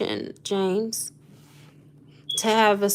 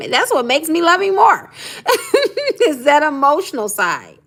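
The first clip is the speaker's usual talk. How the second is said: laughing; 7 semitones higher, 5 dB louder than usual. Real, not acted. Laughing about loving his emotions.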